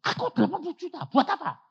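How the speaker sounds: high, fast, astonished question; amazed squeal. Retold or imitated. Preacher asking God why he gets eighty million, high and astonished. Amazed at his own share.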